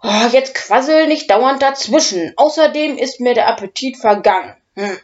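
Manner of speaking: loud, high, fast irritated scolding; angry grumble. Acted. Dragon Udo grumbles angrily at the interrupting professor. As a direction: snap at him, appetite ruined. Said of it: "brummt Udo wütend".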